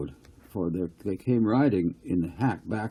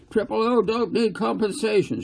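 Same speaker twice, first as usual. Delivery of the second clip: much higher, louder heckling shout; shouting from the crowd; 12 semitones higher, 3 dB louder than usual. A narrator's reading. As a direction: yell it from the back of the crowd. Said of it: "shouted one voice".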